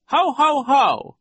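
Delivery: very high, loud ho-ho-ho; theatrical put-on guffaw; hearty mock laugh. A narrator's reading. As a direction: boom out three spelled-out laughs up high. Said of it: "realized, incorrectly".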